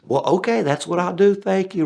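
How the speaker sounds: mock cheerful lady; cheery woman. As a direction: play the clueless lady, chirpy. Woman voiced in the story.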